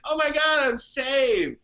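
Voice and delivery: high, loud, gushing "I'm saved"; mock relief. Mocking someone who thinks they are already saved, gushing relief. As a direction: ham up the grateful sigh of relief.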